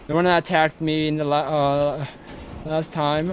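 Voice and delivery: normal talking voice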